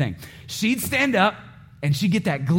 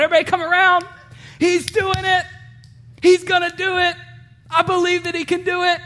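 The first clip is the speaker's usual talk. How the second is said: rally everyone round to watch the little one try; God cheering excitedly; 12 semitones higher than usual. Retold or imitated. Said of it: "He's going".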